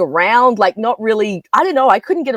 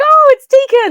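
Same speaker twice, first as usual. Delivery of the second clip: sudden high, loud, thrilled gasp of recognition; high-pitched fangirl delight; excited squeal; 6 dB louder than usual. Retold or imitated. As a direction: squeal like spotting a favourite actor. Delighted recognition of actress on screen.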